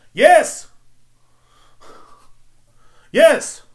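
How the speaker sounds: high, explosive yell; exasperated shout. Real, not acted. Yelling back at imagined shocked celebrities, exasperated shout. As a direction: shout it as the obvious answer.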